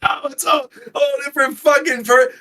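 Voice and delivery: high, loud exasperated yell; outraged shout. Real, not acted. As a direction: cry out in disbelief. Outraged at friend's mistake.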